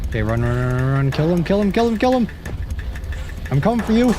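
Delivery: frantic high shouts while fleeing; yelling in a chase, voice up high; being chased. Real, not acted. Being chased, fighting back.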